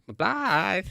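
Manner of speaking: high, drawn-out teasing cry; mock call. Actor sending up his own scene after being teased, mock call. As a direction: imitate people mocking how you call the name. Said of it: "getting the mickey taken out of me".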